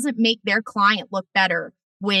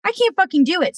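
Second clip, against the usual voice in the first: exasperated; 8 semitones higher, faster than usual. Real, not acted. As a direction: throw up your hands, voice jumping up. Exasperated outburst.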